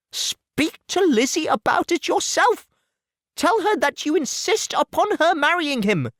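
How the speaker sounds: an anxious mother promising to bring her daughter round; Mrs Bennet, fussing. Acted. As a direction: gush reassurance at the suitor. Mrs Bennet urges husband.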